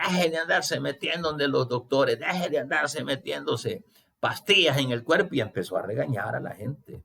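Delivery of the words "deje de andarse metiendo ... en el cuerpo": higher, mock-scolding preacher voice; a hint of a stern preacher telling off the congregation; scolding pastor. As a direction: imitate a pastor scolding his flock, pitched up. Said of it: "un pastor por ahí regañando al rebaño".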